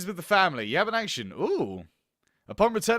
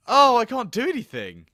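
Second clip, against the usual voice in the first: ordinary talk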